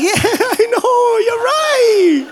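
high, drawn-out sing-song giving in; hammed-up high, slow concession; mock agreement. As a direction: laughingly wail it in mock agreement.

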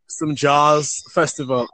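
ordinary talk, nothing marked

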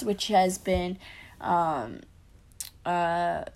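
strained voice cracking mid-sentence; voice giving out. Real, not acted. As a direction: voice giving out, pushing through. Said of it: "I am struggling to talk right now".

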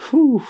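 high loud exhale after laughing; relieved whew. Real, not acted. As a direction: blow out a big whew. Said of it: "Let me catch my breath".

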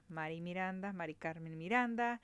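"Mari Miranda, Mari Carmen Miranda" said the Spanish way; "Mari Carmen Miranda" with Spanish vowels and tapped r; Spanish name pronunciation. Shown as a demonstration. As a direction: tap the r's in "Mari Miranda", Spanish style.

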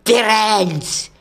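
high, loud outraged shout; thundering. A narrator's reading. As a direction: thunder the name across a clearing. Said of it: "Bane thundered".

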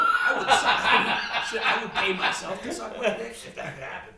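loud, laughing through the words; laughing shout. Real, not acted. Insisting to his friend he'd do it, laughing shout. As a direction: crack up while insisting on it.

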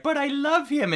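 high breathless ditzy coo; ditzy Anna Nicole. Retold or imitated. Bombshell gushing over her elderly husband, high ditzy coo. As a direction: coo it like a starry-eyed bombshell. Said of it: "pictures of her like".

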